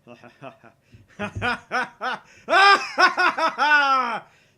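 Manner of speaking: loud, high mad scientist cackle; maniacal laugh. Acted. Okabe celebrates success with a maniacal cackle. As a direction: cackle triumphantly.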